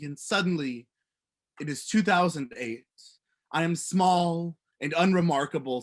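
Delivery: measured spoken-word delivery, building in intensity; poetry reading. Real, not acted. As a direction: recite a poem to a room, projected and increasingly urgent. Reads own poem aloud after introduction.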